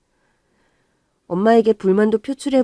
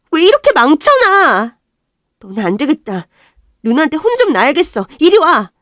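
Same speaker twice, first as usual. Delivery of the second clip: angry shout; 8 semitones higher, 9 dB louder than usual. Acted. Sister scolds brothers over homework.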